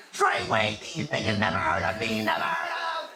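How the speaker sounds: low put-on rapper voice; rapper from a car. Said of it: "passing by saying".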